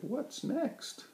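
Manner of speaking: a high what's next; dismayed cry. A narrator's reading. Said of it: "cried Mister Flibbertigibb".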